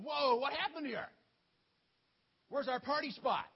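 shocked party-goers exclaiming; astonished whoa. Acted. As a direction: gasp at the wreckage. Townspeople find altar gone.

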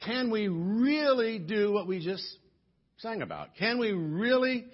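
rising preacher's challenge, stretching always; preaching questions. Acted. Pastor challenging the congregation, preaching. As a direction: press the question on the church.

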